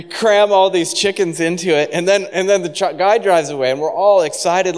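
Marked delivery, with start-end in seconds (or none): none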